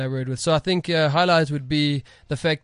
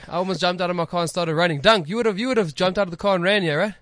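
ordinary talk

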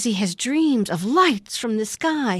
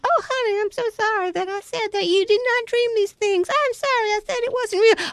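wife voice; 11 semitones higher than usual. Retold or imitated.

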